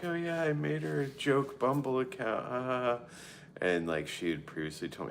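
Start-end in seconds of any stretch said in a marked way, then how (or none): 0.0-3.0 high, loud mock girly voice; mocking the friend. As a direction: squeal it like a giggly friend. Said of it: "her friend was like".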